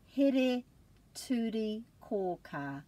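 Here, turi, kō, kā in separate chunks; syllable by syllable. Shown as a demonstration. Teacher modelling long word in chunks. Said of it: "put a break after every vowel".

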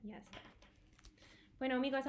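ordinary talk

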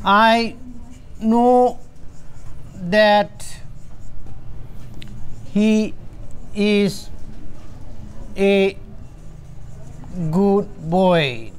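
each word set apart by long pauses; slow dictation. Shown as a demonstration. Teacher dictating an example sentence word by word. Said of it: "Say, for example".

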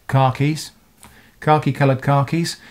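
khakis with a long "ah", like "car keys"; both khaki and khakis sounding like "car key"; UK pronunciation. Shown as a demonstration. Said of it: "we pronounce those two things the same".

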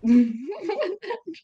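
sudden high laugh; high burst of laughter. Real, not acted. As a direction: crack up laughing. Laughing at her own plan.

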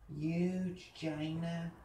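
"Huge China" stretched very slowly, "huge" as "yuge"; Donald Trump. Acted. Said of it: "Donald Trump".

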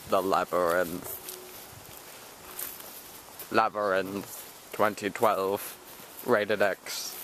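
deep, slow trailer announcer; movie trailer voice. Shown as a demonstration. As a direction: drop low like a movie trailer narrator. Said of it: "Do the voice".

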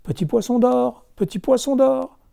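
high call across the water. Acted. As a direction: call the fish from the shore. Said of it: "il appelle".

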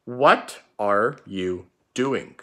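slow dictation, each word drawn out. Shown as a demonstration. Teacher dictating the first sentence slowly to pupils. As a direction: read it slowly for the class to copy. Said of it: "The first sentence is going to be".

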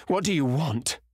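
alarmed, high-pitched demand; frightened gasp of a question. A narrator's reading. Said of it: "he gasped".

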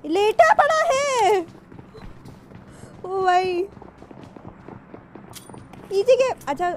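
high, loud excited yell; excited shout. Real, not acted. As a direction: call it out in the heat. Excited call mid-fight.

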